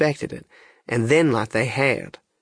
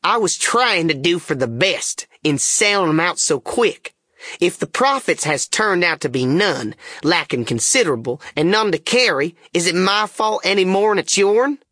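testy self-defence, pitched up; snarling; 6 semitones higher than usual. A narrator's reading. King defending the sale to the Duke, snarling. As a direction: protest crossly, climbing higher toward the closing question. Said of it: "snarls around on him".

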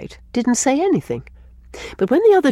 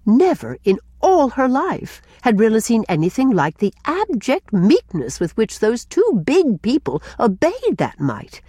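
usual speaking voice

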